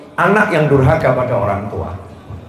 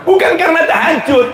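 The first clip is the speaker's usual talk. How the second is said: very high, forceful preacher's voice; full-force sermon delivery, rising to a long final cry; impassioned preaching; 13 semitones higher than usual. Real, not acted. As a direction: press the contrast home at full pitch.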